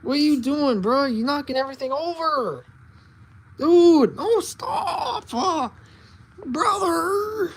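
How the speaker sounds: loud, high protest to a pet; exasperated whine. Real, not acted. Owner as cat knocks everything over, high exasperated whine.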